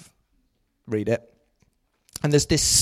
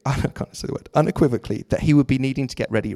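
ordinary talk, nothing marked

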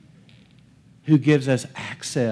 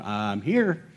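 low, soft, puzzled reply; understated bafflement; 6 semitones lower, 6 dB quieter than usual. Acted. Dad standing there answering kids who want more of him, quietly baffled. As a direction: play a bemused dad, voice dropped.

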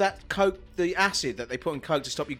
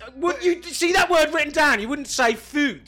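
exasperated; 9 semitones higher, 3 dB louder than usual. Real, not acted. Arguing over pronunciation.

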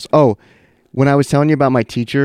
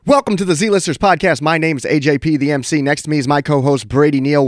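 show host intro; 4 semitones higher, 4 dB louder than usual. Acted. Hosts open the show.

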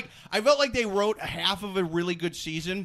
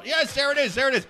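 usual speaking voice

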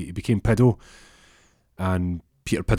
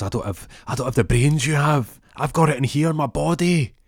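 teammate begging; 7 semitones higher, 4 dB louder than usual. Retold or imitated. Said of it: "He goes".

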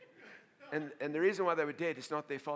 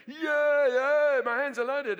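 loud, high, jubilant cry; triumphant shout; 10 semitones higher, 14 dB louder, much slower than usual. Retold or imitated. Pastor recalling the pulpit after people fell, triumphant shout. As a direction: crow it out like a winner.

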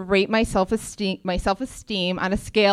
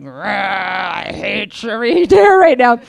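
a growl, then louder grumble; mock angry growl; 5 dB louder, slower than usual. Grumbling back at the stylists, a louder mock growl. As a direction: snarl it like a grumpy bear.

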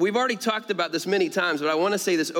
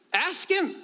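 much higher, insistent "ask him"; high, urging repeat; 11 semitones higher than usual. Real, not acted. Preacher urges congregation to ask, high and insistent. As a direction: urge it with a leap in pitch.

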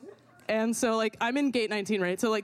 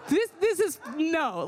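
panicked protest; 7 semitones higher, slower than usual. Retold or imitated. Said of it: "I'm like".

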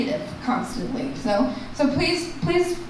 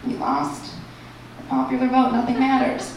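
deflated resignation. Acted. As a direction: let the protest slogan collapse into hopelessness. Shouted slogan collapses into resignation.

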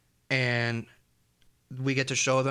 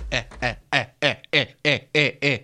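mock stammer; 5 dB louder than usual. Silly noises on air.

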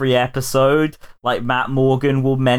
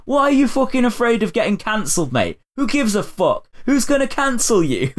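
mock Noel rant; 10 semitones higher than usual. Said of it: "Noel will start banging on".

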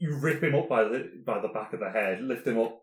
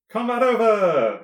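big high shout; loud call; 12 semitones higher, 11 dB louder than usual. Acted. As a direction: call out loudly, drawn out.

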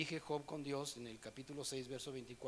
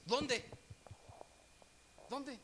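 insistent demand; 9 semitones higher than usual. Retold or imitated. Young man demands the verse.

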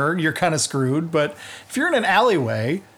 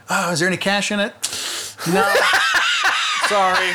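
laughing fake innocence; 3 semitones higher than usual. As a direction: feign innocence, giggling. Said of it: "and say".

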